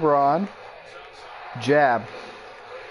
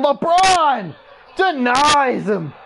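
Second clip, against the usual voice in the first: excited call; 8 semitones higher, 4 dB louder, slower than usual. Real, not acted. Commentator calls LeBron's block to viewers, excited. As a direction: voice leaps up on the block, louder.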